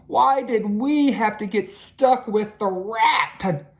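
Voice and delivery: high, slow grumbling complaint; Arthur complaining. A narrator's reading. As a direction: moan to your classmate. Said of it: "he said to Francine".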